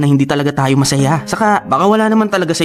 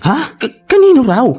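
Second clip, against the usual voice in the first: startled; 10 semitones higher, 3 dB louder than usual. Acted. Friend startled by slip.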